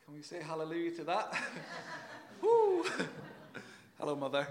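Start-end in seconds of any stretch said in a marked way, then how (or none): 2.4-2.8 high, loud cheer; excited whoop. Real, not acted. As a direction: let out a joyful whoop. Cheering in the congregation.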